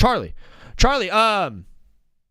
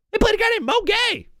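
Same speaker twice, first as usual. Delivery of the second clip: very high, strained disbelief; incredulous squeal. Real, not acted. Streamer astonished at who played big, incredulous squeal.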